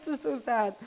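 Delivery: laughing at your own misery; tearful laughing. Real, not acted. Cook crying over onions, laughing. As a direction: burst into a laugh, then talk through it.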